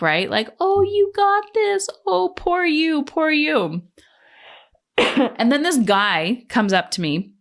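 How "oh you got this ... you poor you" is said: high, mocking sympathetic coo; high-pitched mock sympathy; mock pity. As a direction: parody their pitying encouragement.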